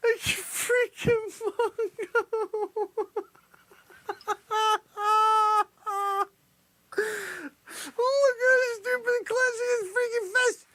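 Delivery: high, drawn-out helpless squeal; laughing to tears. Real, not acted. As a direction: squeeze the words out through laughter. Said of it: "You're making me cry".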